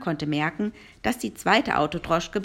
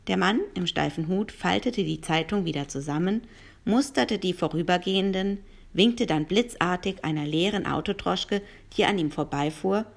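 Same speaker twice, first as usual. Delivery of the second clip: usual speaking voice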